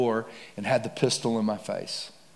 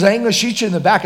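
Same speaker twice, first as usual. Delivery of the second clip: higher, louder and fast; louder insistent refusal; 8 semitones higher, 7 dB louder, faster than usual. Retold or imitated. Robber insisting to the preacher, rushed louder refusal. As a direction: push the refusal out hard.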